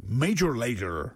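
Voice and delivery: slow, drawn-out Canadian accent. Shown as a demonstration. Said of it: "it needs to be like".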